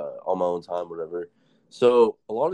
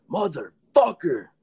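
drawn-out angry motherfucker, higher and louder; mock furious yell; 7 semitones higher, 5 dB louder, much slower than usual. Inmate finds his breakfast milk stolen, mock furious yell. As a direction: explode in slow mock rage. Robbed inmate yells in jail.

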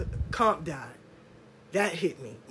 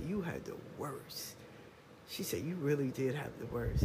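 hushed tearful quote; 7 semitones lower, 13 dB quieter than usual. Retold or imitated. Crying godmother quoted.

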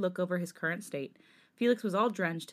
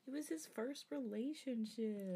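mock sad; 4 semitones higher, 12 dB quieter, slower than usual. Mock mourning the character.

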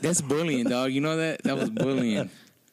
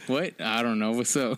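ordinary talk

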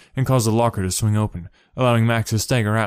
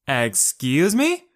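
yelled; 6 semitones higher, much slower than usual. A narrator's reading. Kate yells back at Sho, outraged. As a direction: stretch it in outrage. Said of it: "she yelled again".

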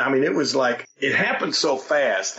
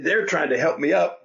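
usual speaking voice

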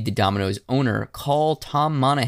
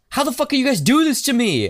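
much higher, louder ranting gunman; mock outraged gunman; 14 semitones higher, 4 dB louder than usual. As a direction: rage at being mocked, hammed up. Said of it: "he's just like".

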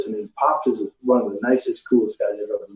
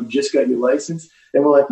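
usual speaking voice

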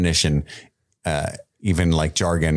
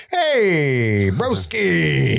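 high, stretched-out mock buddy greeting; Viserys; 7 semitones higher, much slower than usual. King greeting the brother he banished, hammed-up chummy call. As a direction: overdo the chummy hello in a high voice. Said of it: "comforts Daemon, or tries to".

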